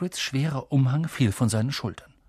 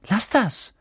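higher, short cry of protest; annoyed command; 8 semitones higher, 4 dB louder than usual. Acted. Boy shooing the pecking owl away, annoyed. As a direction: tell the pest off.

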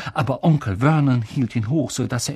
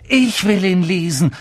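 raised, louder angry insistence; furious; 5 semitones higher, 5 dB louder than usual. A narrator's reading. Harry fights to read his letter, furious. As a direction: insist hotly, pushing the words out. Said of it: "sagte Harry wütend".

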